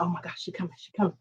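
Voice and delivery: hushed, anxious mutter under the breath; whisper-low panic to herself; scared aside as the girl comes back; nervous hush. Retold or imitated.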